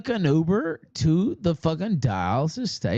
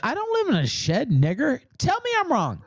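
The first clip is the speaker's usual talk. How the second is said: angry yelling; 9 semitones higher than usual. Real, not acted. Streamer yelling at his rival in a real fight, high and furious. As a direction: pitch jumps high, hurling it back. Heated insult exchange on stream.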